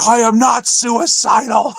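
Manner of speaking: comic yelling; mock yell. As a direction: shout it in a half-hammed imitation. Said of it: "he starts yelling at".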